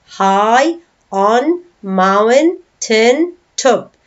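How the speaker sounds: very slow, each syllable set apart. Shown as a demonstration. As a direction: read it out slowly as five separate beats. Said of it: "read this with five syllables".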